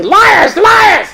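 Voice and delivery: very high shouted squawk; Punch screaming. Acted. Punch screaming at the children, very high shout. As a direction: scream it at the kids, high and puppet-like. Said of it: "he screams at the children".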